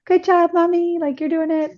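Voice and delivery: put-on high little-boy voice; little son. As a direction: imitate a small child cheering on mommy. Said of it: "my little son came up".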